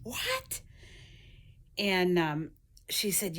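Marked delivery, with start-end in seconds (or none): 0.0-0.6 high, squeaky mock "What?" shooting up; a retold gasp of shock, pitched way up; shocked disbelief. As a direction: imitate your own stunned squeak of disbelief. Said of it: "I'm like".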